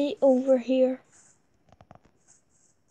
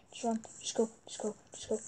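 whispering; 9 dB quieter, faster than usual. Real, not acted. Sneaking past spider.

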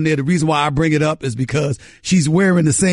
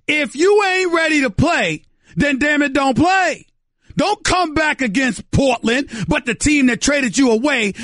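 fired-up shout; 6 semitones higher than usual. Real, not acted. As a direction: shout it like a heated sports take. Heated radio rant.

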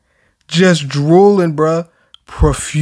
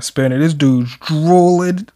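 ordinary talk

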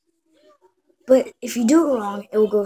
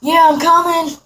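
calling back; 8 semitones higher, 7 dB louder than usual. Acted. Answering a call from inside.

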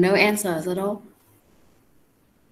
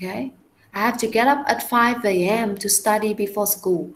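slow model sentence; slower than usual. Shown as a demonstration. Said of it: "rereads the model sentence".

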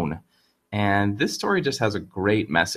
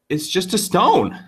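surprised; 9 semitones higher, 3 dB louder than usual. Acted. Man finds only stone.

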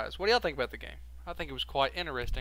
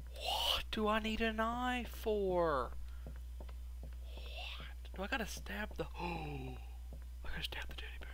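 tense hushed whisper; 5 semitones higher, 10 dB quieter than usual. Real, not acted. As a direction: whisper so nobody hears. Tense hiding in horror game.

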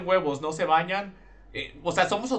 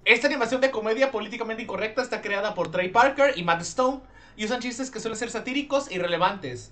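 ordinary delivery

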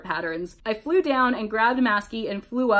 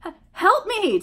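high, loud stammered cry for help; stammer, then yell it high; panicked yell; 11 semitones higher, 5 dB louder than usual. A narrator's reading. Toby snagged by a net, panicked yell. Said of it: "Toby yelled".

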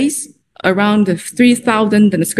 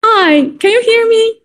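raised and higher, checking the line; to someone hard of hearing; 11 semitones higher than usual. Real, not acted. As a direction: call out over a bad connection. Checking a bad video connection.

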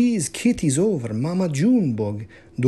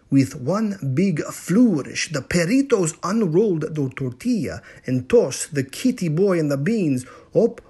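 ordinary delivery

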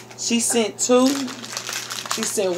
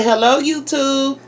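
higher-pitched model hello; chirpy greeting prompt; 6 semitones higher, 3 dB louder than usual. Shown as a demonstration. Parent coaching a child to greet viewers, chirpy. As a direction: say it for a child to echo.

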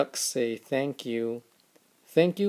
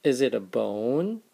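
usual speaking voice

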